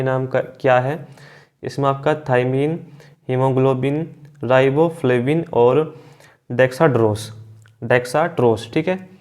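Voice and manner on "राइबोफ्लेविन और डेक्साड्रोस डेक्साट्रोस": ordinary talk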